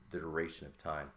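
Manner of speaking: ordinary delivery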